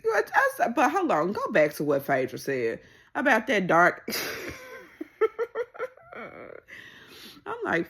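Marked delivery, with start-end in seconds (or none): 4.1-5.9 real high laugh bursting out; high burst of laughter. Real, not acted. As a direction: laugh out high at the dark alley line. Cracking up at the gossip.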